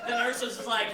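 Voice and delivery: normal talking voice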